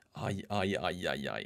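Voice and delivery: very soft, low ouches; low dismayed groaning. Real, not acted. Gamer taking in a bad match, quietly dismayed. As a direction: sink into a quiet, low mutter. Dismay after being substituted.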